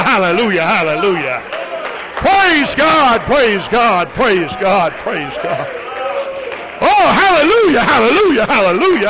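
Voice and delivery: loud praise cries, stepping down in pitch; fervent revival hallelujahs in falling waves; exultant praise. Real, not acted. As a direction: cry each round out high, then let it descend. Shouted praise in refrains.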